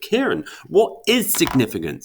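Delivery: high-pitched, put-on puzzled questioner; imagined viewer. Viewer interrupting the teacher to ask about significance, imagined questioner. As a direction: pipe up as an audience member with a question. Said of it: "lovely viewer".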